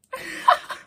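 high squeaky burst of laughter; a sudden high laugh at her own silliness. Real, not acted. After saying silly words to viewers, a high squeaky laugh.

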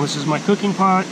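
usual speaking voice

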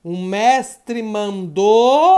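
very slow, stretched-out announcement; drawn-out game call. Pretend. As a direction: stretch each word to build suspense. Anuncia a ordem do jogo. (chamada de jogo bem arrastada)

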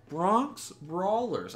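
finally nail "Bronx Brawlers" slowly; careful slow name. Real, not acted. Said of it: "I keep wanting to say Bronx Ballers".

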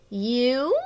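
loud, rising one-word disbelief; startled questioning exclamation; surprised. Acted. Caterpillar hears the pollywog will change too, surprised. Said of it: "The caterpillar was so surprised".

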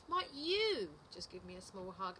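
mildly pleading storybook hedgehog; gently acted begging. A narrator's reading. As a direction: plead for it, leaning loudly into the early words. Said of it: "begged Hedgehog".

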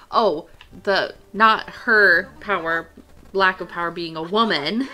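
half-laughing, loud aside; amused outburst. Real, not acted. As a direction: laugh at your own joke out loud. Reacting to her own line.

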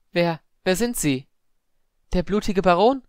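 put-on high-pitched, nervous girl; frightened girl questioning a ghost; slightly shaky. A narrator's reading. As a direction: ask high and hesitant, a little trembly. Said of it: "ein klein wenig zittrig klang".

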